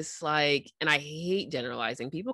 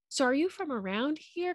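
high-pitched mock small talk; feigned casual curiosity, pitched up; mock polite probing; 10 semitones higher, 4 dB quieter than usual. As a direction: imitate a politely prying colleague.

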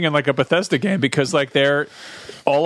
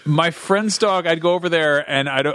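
ordinary talk, nothing marked

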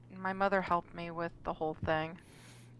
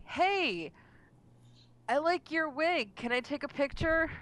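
high, eager stranger voice; imitating guy; 9 semitones higher than usual. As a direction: play the guy asking for a photo.

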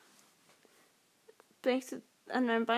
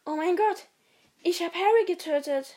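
mock shock; 5 semitones higher than usual. Acted shock over killing Harry.

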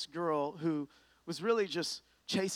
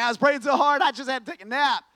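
much louder and higher mock complaint; mock exhausted; 8 semitones higher, 11 dB louder than usual. Student explaining his nap, loud and mock-weary. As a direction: blurt it out comically. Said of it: "He's like".

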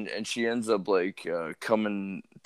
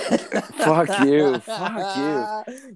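high, laughing comeback; laughing protest; 9 semitones higher, 3 dB louder, much slower than usual. Real, not acted. Teased by his friend about his sister, laughing protest. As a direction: swear back at a teasing friend through laughter.

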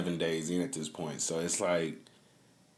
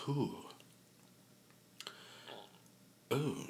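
reacting ooh; 6 semitones higher, 9 dB quieter than usual. Real, not acted. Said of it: "I'm getting some chills".

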